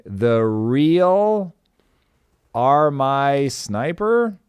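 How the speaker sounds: slow, halting "Are My Sniper", rising at the end; unsure pronunciation. Real, not acted. Host reading an unfamiliar username, unsure. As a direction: sound out "Are My Sniper" as a question. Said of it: "maybe saying that wrong".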